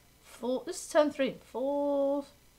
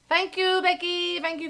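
usual speaking voice

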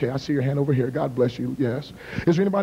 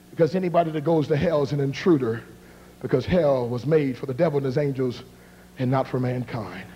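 usual speaking voice

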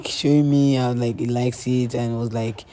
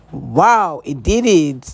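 normal talking voice

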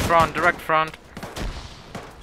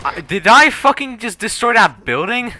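loud, slow, amazed outburst; stunned disbelief; 11 semitones higher, 9 dB louder, slower than usual. Real, not acted. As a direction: blurt it out in shock. Building collapses after his shot.

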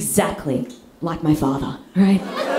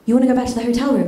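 quick, eager question; mock date's rapid double-take; surprised echo; 4 semitones higher, much faster than usual. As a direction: rush out a hopeful check. Date hopefully echoes her suggestion.

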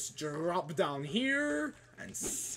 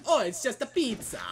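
put-on Italian accent, higher and brighter; 7 semitones higher, 4 dB louder than usual. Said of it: "why I'm doing an Italian accent for that".

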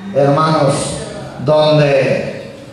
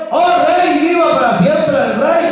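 hailing the king in a much higher voice; fawning courtiers; 11 semitones higher than usual. Acted. Demons hail the king with a high flattering sing-song.

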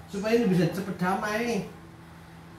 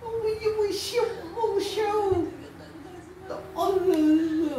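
put-on much higher, slow whiny crying-child voice; imitating Tasya crying; much slower than usual. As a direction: blubber the words like a weeping little girl. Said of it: "ngomongnya itu kayak gitu tuh, Tasya kalau lagi nangis". (menirukan Tasya bicara sambil menangis, tinggi dan lambat)

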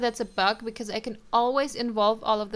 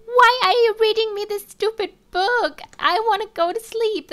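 put-on high, loud, whining kid's complaint; whiny toddler voice; 11 semitones higher, 11 dB louder than usual. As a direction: whine it like a cranky little kid who wants bed. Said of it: "He's like".

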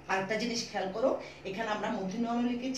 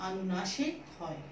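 slow, word by word, with long pauses; slow dictation; 5 dB quieter, slower than usual. Shown as a demonstration. Teacher dictating a rule to pupils, slow and deliberate. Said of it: "ভালো করে বুঝে নাও আমি কি বললাম".

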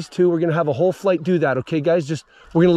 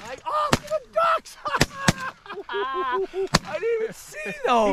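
excited squeal; 4 dB quieter, slower than usual. Real, not acted. Excited at ducks overhead.

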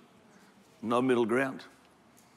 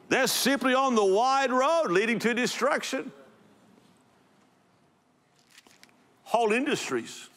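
full-force preaching; 9 semitones higher than usual. Acted. Pastor denouncing crowd-pleasing churches, impassioned preaching.

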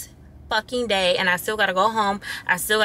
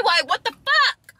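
much higher, flung-up what the fuck; exasperated disbelief; 13 semitones higher than usual. Real, not acted. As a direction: toss it up high in pure disbelief. Exasperated at video delay.

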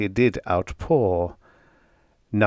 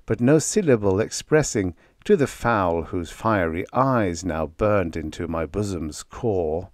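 plain reading voice

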